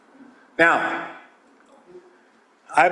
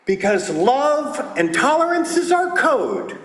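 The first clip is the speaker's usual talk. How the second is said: ordinary talk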